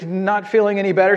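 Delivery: normal talking voice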